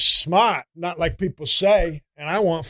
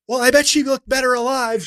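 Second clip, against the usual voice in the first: higher, louder smart-aleck quip; mock Chandler; 8 semitones higher, 7 dB louder than usual. Joker cracking wise at a funeral, mocking impression. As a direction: toss out a tactless joke in a mocking voice. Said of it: "fucking Chandler would show up to my Nono's funeral like".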